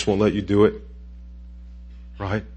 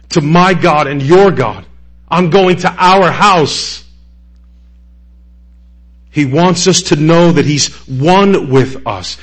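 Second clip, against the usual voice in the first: louder, higher, driving sermon climax; full-force preaching; 5 semitones higher, 7 dB louder than usual. Acted. Preacher proclaiming Jesus' finished work to the congregation, full-force preaching. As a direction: preach it out, hammering each phrase.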